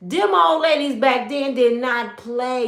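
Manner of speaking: ordinary talk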